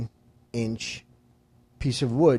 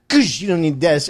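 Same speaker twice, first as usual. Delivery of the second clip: loud, higher, offhand verdict; retold brash dismissal; 4 semitones higher, 6 dB louder than usual. Buddy kicking out the wood, brashly dismissive. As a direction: blurt it while booting something away.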